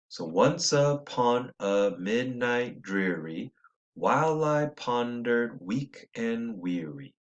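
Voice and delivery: slow, even da-DUM beat through "once upon a midnight dreary"; rhythmic reading. Shown as a demonstration. Teacher reading the couplet to show its iambic beat. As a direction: lean into each stressed beat, slowly. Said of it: "an unstressed and stressed syllable".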